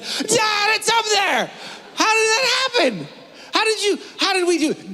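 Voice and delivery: put-on very high, astonished child voice; amazed kid. Son finding the Xbox upstairs, high amazed kid voice. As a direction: squeal it like a stunned little boy.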